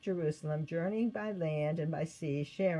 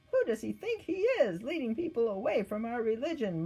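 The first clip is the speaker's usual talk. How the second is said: jealous mutter; 6 semitones higher, 3 dB louder than usual. Acted.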